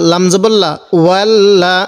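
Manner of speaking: ordinary talk, nothing marked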